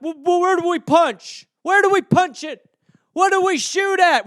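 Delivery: high, loud flustered questions; mock bafflement. The country confused by climate change, loud mock panic. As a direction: flail around for something to punch.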